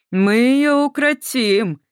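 медленно, громче, с похабной насмешкой; смакует угрозу; сальная усмешка. A narrator's reading. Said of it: "сальной усмешкой ответил другой". (другой нацист сально насмехается над Эстер)

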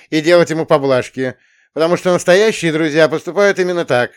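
loud high preachy lecture; lecturing counselor. Acted. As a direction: moralize at the boy loudly.

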